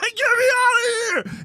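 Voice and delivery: imitating a man's high, desperate screaming; high pitched scream. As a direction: shriek it like he's trapped and terrified. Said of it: "that high pitched scream".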